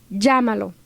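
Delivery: normal talking voice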